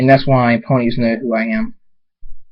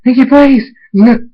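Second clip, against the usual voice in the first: high, loud desperate begging; screamed plea; 13 semitones higher, 8 dB louder than usual. Acted. Trapped voice begging Pinkie to stop, screaming. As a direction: cry out high and sharp. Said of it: "The Train's voice screams".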